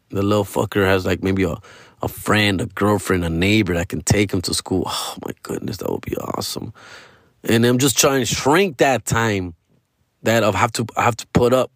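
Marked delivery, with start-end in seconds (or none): 4.9-6.7 hushed, dreamy whisper; whispered relief. Real, not acted. Dad whispering about a friend driving his son, hushed. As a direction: breathe it out like a secret wish.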